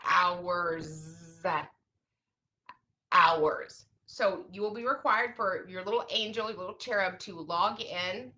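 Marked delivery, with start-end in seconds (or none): 0.1-1.1 hours, held out long; dragged-out word; drawn-out hours. Shown as a demonstration. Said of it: "repeated word". 3.1-3.9 hours, said short and louder; emphatic hours; punched hours. Shown as a demonstration. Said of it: "repeated word".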